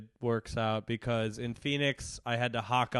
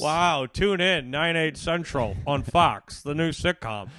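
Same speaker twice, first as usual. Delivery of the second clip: TV promo announcer; 3 semitones higher, 9 dB louder than usual. Pretend. Mock TV promo.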